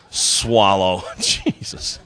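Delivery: a low, soft, nearly whispered mutter; muttered aside. Real, not acted. Comic muttering to himself after the heckler story, hushed. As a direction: mumble it to yourself, shaking your head.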